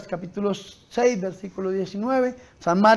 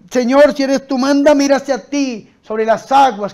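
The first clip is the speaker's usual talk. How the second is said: loud, raised preacher's retelling of a plea; fervent calling out; 7 semitones higher, 10 dB louder than usual. Retold or imitated. Peter to Jesus from the boat, fervent calling out. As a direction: call boldly to the Lord.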